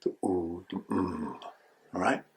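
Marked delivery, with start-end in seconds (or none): 0.0-0.6 a soft, drawn oo; a hushed oo; oo sound. Shown as a demonstration. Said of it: "work with those three sounds". 0.7-1.3 a soft hummed mm; a quiet closed-lip hum; mm sound. Shown as a demonstration. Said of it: "work with those three sounds".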